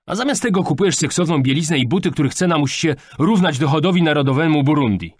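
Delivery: high, loud rant; indignant tirade building in force; angry scolding. A narrator's reading. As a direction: berate her for wasteful spending. Father berates daughter over shopping.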